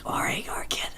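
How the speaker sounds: put-on drunk man whispering; imitating a drunk passenger's whisper. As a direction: hush it right down. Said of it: "What are you whispering for?".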